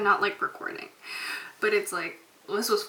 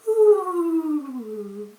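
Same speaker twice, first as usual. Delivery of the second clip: spooky laugh; 6 semitones higher, 6 dB louder than usual. Pretend. Spooky cackle after kiss.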